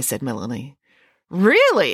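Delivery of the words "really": high, loud burst of surprise; surprised exclamation. A narrator's reading. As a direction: exclaim it in sudden disbelief. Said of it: "exclaimed Friday".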